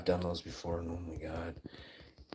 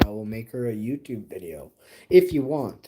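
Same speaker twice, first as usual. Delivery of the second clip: ordinary talk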